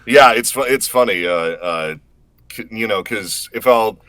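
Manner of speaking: ordinary talk, nothing marked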